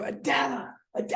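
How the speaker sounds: high, held-back scream of a name; retold scream. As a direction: imitate screaming for your wife. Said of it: "I'm screaming to my wife".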